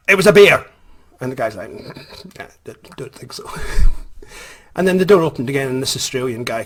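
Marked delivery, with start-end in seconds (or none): 0.1-0.7 loud, high outburst at a doubter; indignant insistence. Retold or imitated. Insists angrily to the doubting ranger. 2.4-3.5 hushed, whispered sceptical reply; dismissive doubt. Retold or imitated. Ranger doubts him, voice nearly gone.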